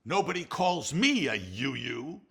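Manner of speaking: loud, slowed, indignant whimper; mock-offended Stan Laurel; Stanley's protest. Stanley answering Ollie's insults, loud hammed-up indignation. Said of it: "Stanley suddenly goes".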